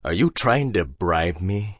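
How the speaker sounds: stern, slow, low question; cold stern warning. A narrator's reading. Hagbard confronting Harry, slow stern question. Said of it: "Hagbard asked sternly".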